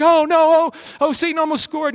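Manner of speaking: high, hammed-up gasp of alarm; mock panic. Preacher mocking fears that Satan might win, high mock panic. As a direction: gasp like your team nearly lost. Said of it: "You're like".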